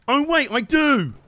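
high, loud, drawn-out whoop; delighted realisation. Real, not acted. Gamer remembering his ranged weapon, delighted shout. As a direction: crow with sudden glee.

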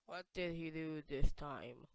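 ordinary talk

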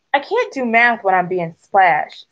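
plain reading voice

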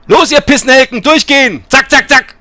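loud, high drill-like shouting; punchy, impatient yelling; barked orders. Pretend. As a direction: hurry the passengers along at a shout. Orders passengers to move through.

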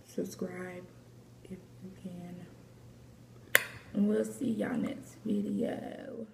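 soft unvoiced whisper. Real, not acted. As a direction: whisper the sign-off. Closing whisper.

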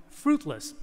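normal talking voice

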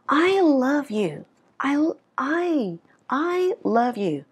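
"I" held long and high, then "love you" falls; stress on I. Shown as a demonstration. Teacher showing learners who does the loving, stress on I. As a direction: lean hard on "I", drawing it out. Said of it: "[name] nhấn vào từ I".

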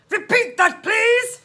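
loud, high police demand. A narrator's reading. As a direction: demand it loudly down the line. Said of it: "demanded the voice".

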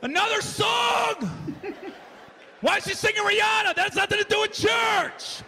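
loud, high, slow exasperated shouting; impatient yelling. Retold or imitated. Bored visitor yells that the service runs too long. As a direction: bellow every complaint, fed up. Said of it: "I'm getting upset".

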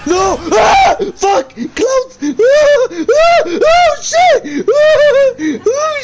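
high, loud screams with crying laughter; panic-shout while running; terrified screaming. Real, not acted. Streamer fleeing clowns, screaming in terror.

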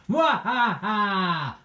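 slow hammy villain cackle, high and loud; evil laugh. Pretend. Villain gloats over gum alarm.

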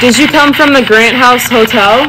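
loud calls to a ghost; calling out loud. Real, not acted. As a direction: demand answers from the spirit. Loud questioning of spirit.

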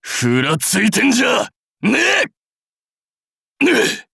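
high, forceful taunting shout; combat yell. Acted. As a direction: yell it at a staggering foe. Yelling mid-battle.

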